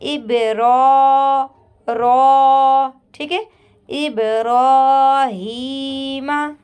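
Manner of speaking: tajweed demo, heavy ra with standing zabar. Shown as a demonstration. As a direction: draw out इब्राहीमा with a thick रा. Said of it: "रा को हम पुर पढ़ेंगे".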